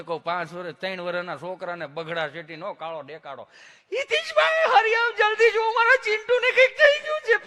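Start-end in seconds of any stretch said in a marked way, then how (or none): 3.9-7.5 very high, loud panicked cry for help; panicked shriek. Retold or imitated. A frantic mother screaming to the host that her child is hurt.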